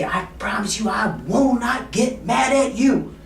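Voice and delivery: high, loud, slow mock warning; mock stern. Shown as a demonstration. Salesman teasing his client, mock stern. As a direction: stretch it out like a fake threat. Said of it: "when I say".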